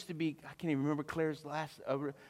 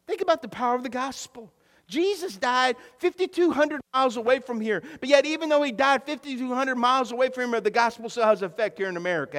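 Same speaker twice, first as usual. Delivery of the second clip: high, loud, full-force sermon delivery; impassioned preaching; 7 semitones higher, 8 dB louder than usual. Real, not acted. Pastor declares belief in providence to church, impassioned preaching. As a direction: preach it out hard, punching key words.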